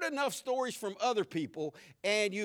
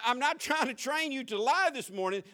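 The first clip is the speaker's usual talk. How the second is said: ordinary delivery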